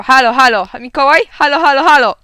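loud, high phone shouting; calling out. A narrator's reading. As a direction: holler hellos to be heard down the line. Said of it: "który wołał". (głośne wołanie do telefonu)

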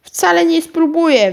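high, loud refusal; rude yell. A narrator's reading. Karolcia snaps at her aunt offering cake, rude yell. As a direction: yell it back impolitely. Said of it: "wrzasnęła niezupełnie grzecznie Karolcia".

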